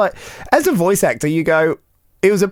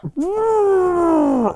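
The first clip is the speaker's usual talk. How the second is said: whale noise. Retold or imitated. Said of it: "it was just like".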